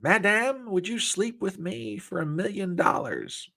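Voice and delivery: W C Fields voice. Retold or imitated. Joke man propositioning a woman, in a high put-on comic voice. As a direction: croon it like a vaudeville charmer. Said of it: "he looks at her and says".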